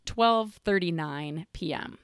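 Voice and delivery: ordinary talk, nothing marked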